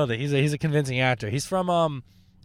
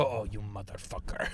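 mock angry scammer; 4 semitones lower, 8 dB quieter than usual. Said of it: "I've had one go".

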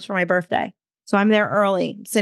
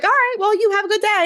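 much higher, strained polite farewell; awkward high goodbye; 12 semitones higher than usual. Retold or imitated. Narrator flustered, bidding the woman good day in a high voice. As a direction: back away with a forced cheery send-off.

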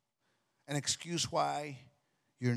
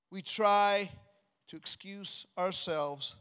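usual speaking voice

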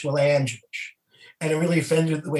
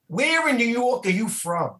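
high, slow mock New York yell; over-exaggerated New York accent; 7 semitones higher than usual. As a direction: heckler calls out from the crowd in a hammed-up New York accent. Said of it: "over-exaggerated New York accent".

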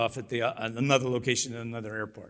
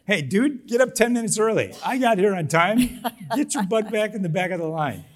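much higher, louder scolding; indignant rant; 10 semitones higher, 6 dB louder than usual. Retold or imitated. Scolding someone who cuts the line, a higher, louder rant. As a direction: tell the line-cutter off. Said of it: "It would be like".

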